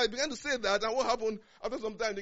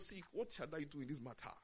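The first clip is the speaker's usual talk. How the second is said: humble prayer; 4 semitones lower, 14 dB quieter than usual. Retold or imitated. David's humble prayer to God.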